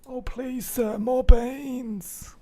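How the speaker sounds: high, drawn-out plea; begging orphan voice. Acted. Line stuck in his head, high pleading voice. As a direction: beg in a small, high, stretched voice.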